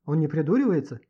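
usual speaking voice

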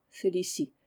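ordinary delivery

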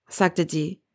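usual speaking voice

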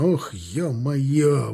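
slow, drawn-out exasperated oath; mild cursing. A narrator's reading. Sergeant hearing of civilians inside, mild curse. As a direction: swear slowly in dismay. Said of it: "выругался унтер".

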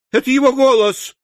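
high, loud hysterical outcry; shrieked recognition, near a fit; exclaiming. A narrator's reading. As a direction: cry out like an overwrought aunt. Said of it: "воскликнула незамужняя тётушка".

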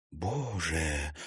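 soft, stunned exclamation; a shocked woman whispering to herself; stunned utterance. A narrator's reading. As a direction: barely get the word out in shock. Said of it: "только и вымолвила Сухорукова".

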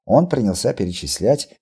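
ordinary delivery

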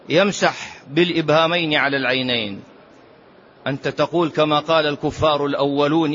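normal talking voice

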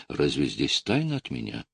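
usual speaking voice

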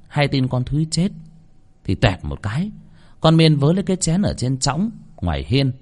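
ordinary delivery, nothing marked

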